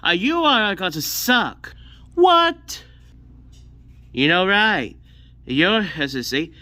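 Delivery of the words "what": high, loud startled yelp; shocked what. Acted. Friend told he will suck, yelps in shock. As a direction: jolt up in disbelief.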